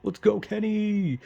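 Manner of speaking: high, slow hammed-up cheering; mocking himself cheering at the screen for Kenny. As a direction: overdo the fan hype for Kenny. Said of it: "I was like".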